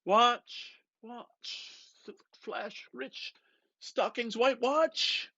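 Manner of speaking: eager watching. Acted. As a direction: Bloom straining to see her, eager. Bloom eagerly watching stockings.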